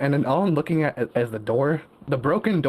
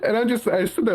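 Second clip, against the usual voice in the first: laughing; 9 semitones higher than usual. Real, not acted. Still laughing.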